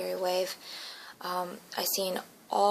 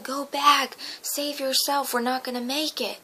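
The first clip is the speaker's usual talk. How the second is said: raised, urgent mock yell; higher-pitched yell, imitating a desperate dad; retold scream; 5 semitones higher, 4 dB louder than usual. Dad warning child away from the wave, retold screaming. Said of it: "My dad kept screaming".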